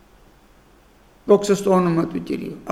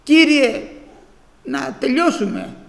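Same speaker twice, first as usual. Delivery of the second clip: retold crying out; 5 semitones higher, slower than usual. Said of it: "Φωνάζουνε".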